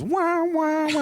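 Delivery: high, loud wah wah wah; sad trombone. Retold or imitated. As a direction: imitate a losing sound effect. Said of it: "And it just goes".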